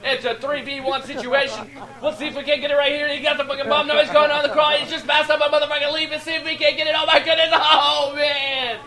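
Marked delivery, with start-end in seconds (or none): 2.0-8.8 high-pitched, urgent clutch commentary; excited play-by-play. Real, not acted. Commentator calling a three v one situation, excited and building.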